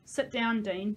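plain reading voice

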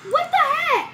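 high startled outburst; shocked. Real, not acted. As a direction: blurt it out still rattled. After the scare.

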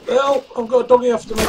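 high, loud cry for help; yelling for help in mock panic; panicked shout. Chased by a dog in game.

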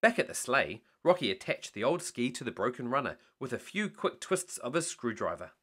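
usual speaking voice

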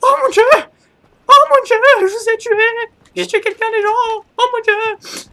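very high, loud squealing cries; panicked shrieking. Real, not acted. Panicking after kills in game.

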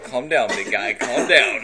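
loud put-on calming down; mock placating. Answering the big guy's challenge, mock placating. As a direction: tell him off playfully, with volume.

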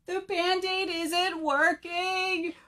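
high, slow wail building to a loud boo-hoo; hammed-up storybook bawling; crying bird. A narrator's reading. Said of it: "cried Bird".

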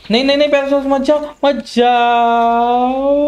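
high, rushed plea ending in a long drawn-out cry; frantic warning. Real, not acted. Gamer begging the pet dinosaur to stay, panicked. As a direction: call the pet back in panic.

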